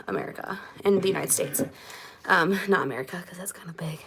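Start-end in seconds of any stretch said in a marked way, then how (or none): 2.3-4.0 whispering. Real, not acted. Quick correction aside.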